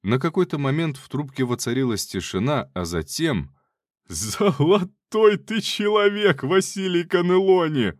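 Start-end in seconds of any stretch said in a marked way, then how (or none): 4.1-7.9 high, slow delighted laughing. Acted. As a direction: laugh out the praise heartily. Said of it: "рассмеялся барон Губарев".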